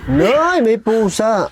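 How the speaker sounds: high-pitched real jolt of fright; startled yelp. Real, not acted. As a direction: yelp it out high, caught off guard. Startled by cat in horror film.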